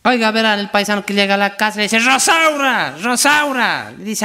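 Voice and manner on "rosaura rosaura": calling out. Acted. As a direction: call Rosaura across the house. Husband calls wife at home.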